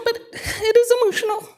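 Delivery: an embarrassed laugh that breaks into crying; laughing through tears. Real, not acted. Speaker apologizing to council for tears, laughing while crying. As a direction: apologize with a shaky laugh, then cry. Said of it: "sorry about getting emotional".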